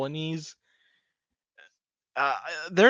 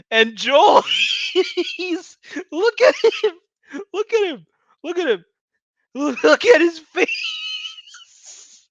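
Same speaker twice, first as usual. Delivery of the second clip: high cackling laugh breaking words; laughing hard; 7 dB louder than usual. Real, not acted. Mocking Joel's face on screen, howling with laughter. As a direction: loses it laughing, voice shooting up.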